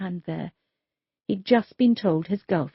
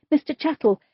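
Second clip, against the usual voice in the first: higher and louder, cutting in to calm him; urgent plea; 8 semitones higher, 4 dB louder than usual. Acted. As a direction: call his name to stop the rant. Officer tries to calm him.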